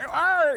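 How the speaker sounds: high, loud imitation crowd roar; crowd cheer. Taste buds cheering like a home run, mock crowd roar. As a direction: cheer like a whole stadium. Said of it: "reared up like a crowd".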